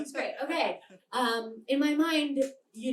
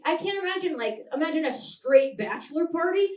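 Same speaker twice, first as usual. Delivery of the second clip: ordinary delivery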